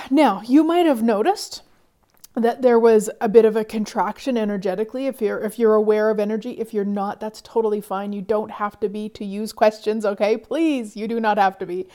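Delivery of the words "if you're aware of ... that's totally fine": ordinary delivery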